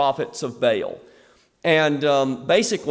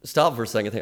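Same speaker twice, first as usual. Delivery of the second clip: normal talking voice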